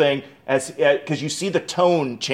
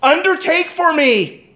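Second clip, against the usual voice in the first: desperate plea; 12 semitones higher than usual. Acted. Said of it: "Hezekiah says".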